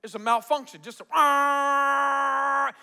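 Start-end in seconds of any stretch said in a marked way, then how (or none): 1.1-2.7 be the stuck Chrysler horn; imitating car horn. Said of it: "it's not like a Honda horn".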